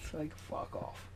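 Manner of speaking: breathy whispered dismissal; whispered aside. Real, not acted. Brushing off the neighbour story, whispered. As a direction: whisper it off to the side. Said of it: "whispered aside".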